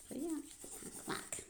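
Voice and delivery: whispered. Acted. Repeats while writing.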